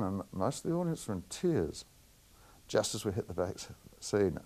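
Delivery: ordinary talk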